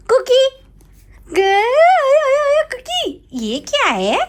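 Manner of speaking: very high, sing-song cooing. Real, not acted. Mother cooing to her baby son in very high baby talk.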